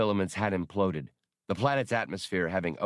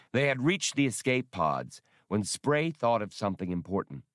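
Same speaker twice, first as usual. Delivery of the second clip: ordinary delivery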